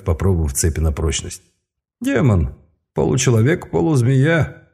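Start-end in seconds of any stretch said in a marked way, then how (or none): 2.0-4.6 higher, icy sorcerer explaining; cold mage voice. Acted. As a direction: lay it out like a sinister mage.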